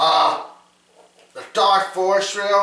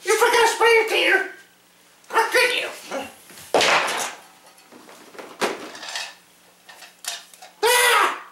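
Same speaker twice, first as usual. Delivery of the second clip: high squeaky toy voice; faster than usual. Pretend. Toy character scolds, then screams.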